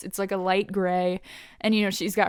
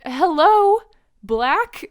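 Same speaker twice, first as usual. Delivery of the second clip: sarcastic hello; 8 semitones higher, 6 dB louder, much slower than usual. Retold or imitated. Luke mocking Ryan's claim.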